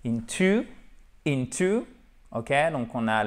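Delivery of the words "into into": "into" said higher, rising at the end; "into" picked out for learners, pitched up; stressed syllable demo. Shown as a demonstration. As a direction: lift "into" to show its accent. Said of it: "vous avez l'accentuation ici".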